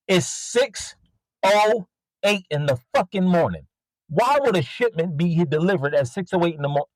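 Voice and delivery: high-pitched exasperated rant; rant, fed up and incredulous. Real, not acted. Seller ranting about customers messaging at dawn, exasperated.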